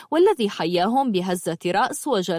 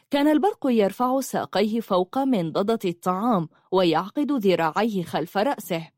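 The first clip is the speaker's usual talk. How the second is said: usual speaking voice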